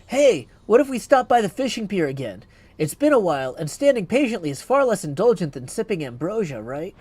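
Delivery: friendly goddess proposing an outing; young goddess voice. Acted. Reads heroine Melinoë's lines.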